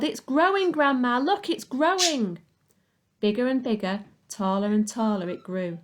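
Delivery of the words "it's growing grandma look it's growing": high, loud thrilled shout; excited yell. A narrator's reading. Boy watching the hen grow, a high excited yell. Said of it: "he yelled".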